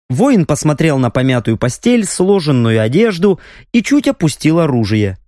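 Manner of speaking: usual speaking voice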